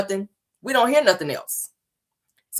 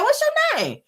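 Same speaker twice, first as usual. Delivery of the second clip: grown-up asking; 3 dB quieter, slower than usual. Retold or imitated. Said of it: "You be like".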